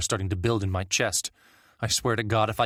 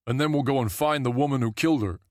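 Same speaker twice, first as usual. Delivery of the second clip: vengeful villager; 5 semitones higher than usual. A narrator's reading. Another voice telling Mark they will hunt the killer, vengeful. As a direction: push for the hunt.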